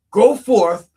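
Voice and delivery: God orders Elijah out, loud commanding boom. Acted. God's command, booming.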